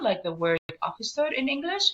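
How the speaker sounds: ordinary delivery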